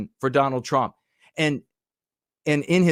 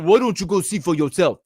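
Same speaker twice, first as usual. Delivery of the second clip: a raised, half-mocking imitation of a heckler's shout; 5 semitones higher than usual. Commentator mocking a heckling reporter, hammed-up mock shout. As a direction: throw the question back like a sneering heckler, a bit higher.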